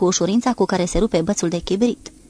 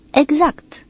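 one higher curt word; terse reply; 8 semitones higher, 4 dB louder than usual. A narrator's reading. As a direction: cut him off coldly. Captain's terse reply to officer. (răspuns sec al comandantului)

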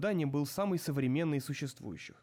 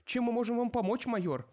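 eager flustered underling; 8 semitones higher than usual. Acted.

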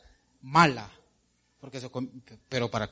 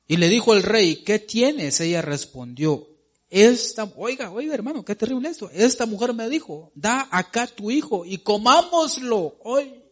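booming pulpit reading; emphatic scripture reading; 7 semitones higher, 6 dB louder than usual. Acted. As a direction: read it like a preacher building the scene.

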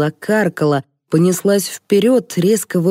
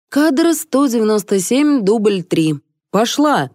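higher, carrying announcement; routine call-out; 6 semitones higher than usual. A narrator's reading. Crew member calls take three on a film set, calling out.

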